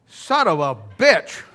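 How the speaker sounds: loud, very slow, punching BITCH; drawn out and forceful, hammering the last word; emphatic defiant repeat. Retold or imitated. Defiantly repeats it to objecting clerk.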